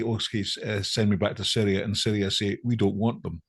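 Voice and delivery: ordinary delivery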